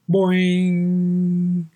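high, long-held ringing clock chime; klokslag nadoen. A narrator's reading. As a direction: imitate a striking clock, let it ring out. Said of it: "Daar hoorde hij de klok slaan". (hoge, galmende klokslag)

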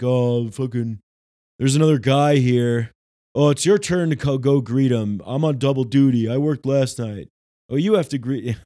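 loud mock workplace complaint; put-upon grumbling. Entity on double duty arguing over who greets the visitor, loud mock grumbling. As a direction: grouse loudly like an overworked employee.

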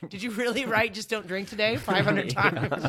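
laughing through the son's words, loud; laughing mock son. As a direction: play the son teasing you while you crack up. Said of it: "be like".

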